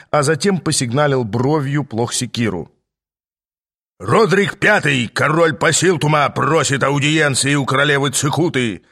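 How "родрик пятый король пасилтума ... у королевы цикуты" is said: barked announcement. A narrator's reading. Guard announces the king to the queen, barking. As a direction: bark it out like a royal herald. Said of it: "рявкнул он".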